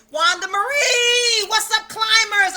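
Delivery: loud, high, drawn-out hello; excited greeting. Real, not acted. Host welcoming a viewer into the live stream, loud and excited. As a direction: call out the name with delight.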